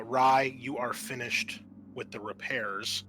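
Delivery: normal talking voice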